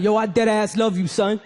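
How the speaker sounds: lightly put-on New York street accent; New York gangster vows. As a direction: declare love in tough New York slang style. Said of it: "the most New York gangster vows".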